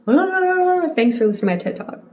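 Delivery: high, fast mocking blah-blah voice; mock rant voice. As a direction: squeak it out fast, teasing yourself. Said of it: "I was just like".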